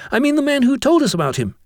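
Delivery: put-on high-pitched boy explaining himself; eager schoolboy. Jennings explains himself to the master, high eager voice. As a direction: gush out your story to the teacher.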